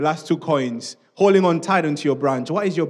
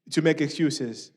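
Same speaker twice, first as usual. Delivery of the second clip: ordinary talk, nothing marked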